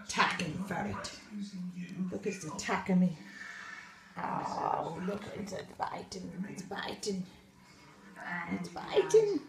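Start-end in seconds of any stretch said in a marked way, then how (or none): 0.1-6.3 low, breathy, fast monster voice; deep put-on voice. Deep mock voice for attacking ferret. 6.5-7.2 low, hushed creature voice on It's biting; deep put-on voice. Deep voice then plain retake.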